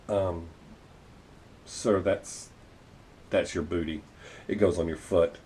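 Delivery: a quiet, patient nurse correcting a clueless dad; calm, polite nurse. Retold or imitated. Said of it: "she very calmly and politely said".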